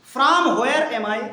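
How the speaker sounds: drawn out, word by word; teacher drilling the question slowly for students; slow model reading. Shown as a demonstration. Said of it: "teacher models the question slowly".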